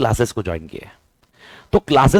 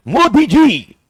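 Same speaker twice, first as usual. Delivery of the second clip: mock calling out; 10 semitones higher, 4 dB louder, much slower than usual. Mock appeal to the Prime Minister.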